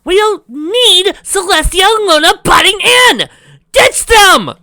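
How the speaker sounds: high, loud, slow exasperated yell; exasperated shout. Real, not acted. Reader yelling at the fanfic's characters, loud and high. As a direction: yell at the story in frustration.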